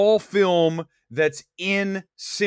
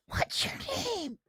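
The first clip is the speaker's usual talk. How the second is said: put-on high eerie ghost child; creepy child voice; 10 dB quieter than usual. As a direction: ask it like a lost ghost child. Imitating film child voice.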